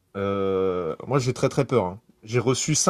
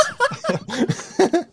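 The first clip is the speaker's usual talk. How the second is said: high laugh. Real, not acted. Laugh burst.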